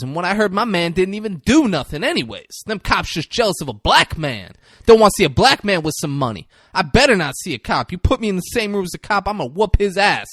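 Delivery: high, fast-talking ranter; mock blustering tirade; Clifford Franklin. Acts out ranting Clifford Franklin.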